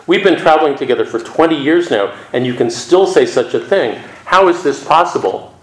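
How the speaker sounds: lightly acted incredulous outburst; exclaimed disbelief. Dongshan to his companion of twenty years, exclaiming in lightly acted disbelief.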